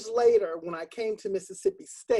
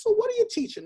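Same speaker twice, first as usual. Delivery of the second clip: higher, lightly voiced old man; elderly man; 14 semitones higher, 3 dB louder, faster than usual. Old man making small talk with teacher, curious. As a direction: ask it with folksy interest. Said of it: "He goes".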